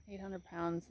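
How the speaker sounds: ordinary delivery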